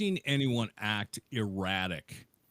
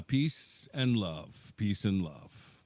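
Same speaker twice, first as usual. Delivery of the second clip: slow peace and love; 2 semitones lower, slower than usual. Pretend. Mellow stoner mantra repeated.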